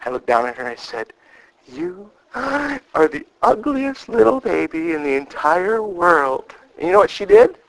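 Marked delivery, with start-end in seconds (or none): 1.7-6.6 sweet tone, harsh words. Shown as a demonstration. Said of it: "how we say something".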